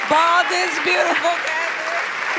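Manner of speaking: loud, high cheering echo; excited shout. Real, not acted. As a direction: cheer it back to her. Host echoes the rallying cry.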